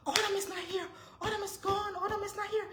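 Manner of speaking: panicked shout. Retold or imitated. Mother shouting to her sick husband that their daughter is gone, very high. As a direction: shout the alarm to wake the house. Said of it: "And I start shouting".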